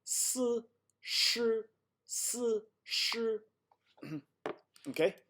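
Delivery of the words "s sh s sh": "S" then "sh", each a separate voiceless sound; no spitting or vibrating. Shown as a demonstration. Teacher asking the student to note it, unvibrated s and sh. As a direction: alternate "s" and "sh" without buzz or spit. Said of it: "I'm not spitting. I'm not vibrating".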